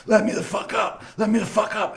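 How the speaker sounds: high, strained mock plea; squeezed, pinned bully. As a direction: imitate someone yelling with the air squeezed out. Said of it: "squeezing the fucking air out of him".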